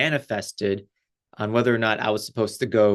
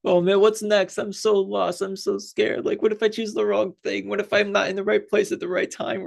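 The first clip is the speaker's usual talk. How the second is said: higher, whiny mock worry; mock panicked self; 9 semitones higher than usual. Mocking her own worried inner voice, high and whiny. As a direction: play your anxious self, pitched way up. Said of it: "I'm always just like".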